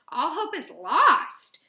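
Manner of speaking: slow, loud lament; despairing outburst. Acted. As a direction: let despair swell through the words. Girl despairs at being trapped.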